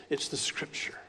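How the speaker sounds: breathy hushed whisper, almost unvoiced. Real, not acted. Preacher whispering to his congregation about authority, hushed. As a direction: lean in and whisper it like a secret.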